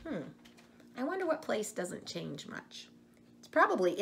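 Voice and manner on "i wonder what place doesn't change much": usual speaking voice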